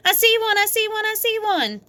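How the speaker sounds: high, eager cry; excited shout. A narrator's reading. Sheldon spotting an egg, high excited shout. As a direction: spot the egg, thrilled. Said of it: "he shouted".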